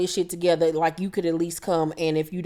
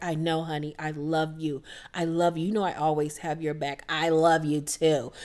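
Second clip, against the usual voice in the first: soft reassuring Lisa; 4 semitones lower, 5 dB quieter than usual. Retold or imitated. Lisa comforting Erica, retold.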